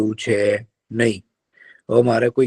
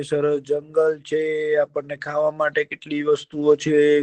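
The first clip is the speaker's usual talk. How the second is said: kind deer; 4 semitones higher than usual. Acted. Deer cheers up the sad fox, coaxing. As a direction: soothe him like a gentle deer.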